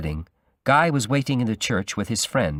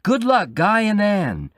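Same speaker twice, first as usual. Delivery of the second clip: high, loud cheering shout; crowd shouting; 11 semitones higher, 4 dB louder than usual. A narrator's reading. Wedding guests shouting congratulations to Guy, loud cheer. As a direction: call it out over the wedding crowd. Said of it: "People were all shouting".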